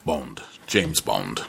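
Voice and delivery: low, slow, smooth spy line; suave spy catchphrase. Acted. Friend drops the famous spy line, low and slow. Bond catchphrase during Bond talk.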